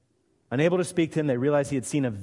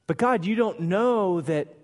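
higher, louder, drawn-out complaint; protesting to God; 8 semitones higher, 5 dB louder than usual. Acted. Believer objecting to God, slow loud protest. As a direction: plead your case slowly and loudly, higher voice. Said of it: "I think we do this sometimes".

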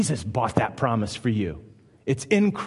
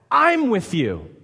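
emphatic reassurance; 7 semitones higher, 4 dB louder, slower than usual. Acted. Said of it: "preaching God's reassurance".

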